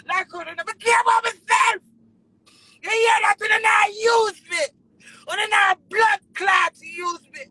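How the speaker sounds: loud, high tearful shouting, slow on the last lines; crying rant. Real, not acted. Artist yelling at rivals who used her, crying shout. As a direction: yell through the tears.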